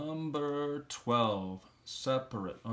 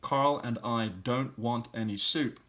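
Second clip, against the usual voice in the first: slow read-through; 4 dB louder, slower than usual. Shown as a demonstration. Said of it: "I'll read it through".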